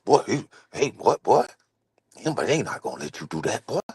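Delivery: nervous hushed mimicry; scared mock voice. Host imitating a scared friend, hushed and nervous. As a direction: play someone scared talking you out of it. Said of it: "They talk like this".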